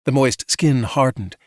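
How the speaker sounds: plain reading voice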